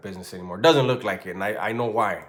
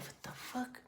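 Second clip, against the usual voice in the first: breathless, stunned whisper; shocked whisper; 14 semitones higher, 19 dB quieter than usual. Retold or imitated. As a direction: breathe it out in disbelief. Stunned reaction to news.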